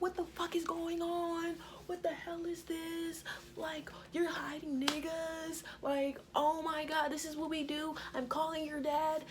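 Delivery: high, slow outraged mom voice, dropping to a hiss; furious mom. Retold or imitated. Friend's mom scolding her daughter about the boys, high outraged rant. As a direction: rant like a mom who caught her kid lying.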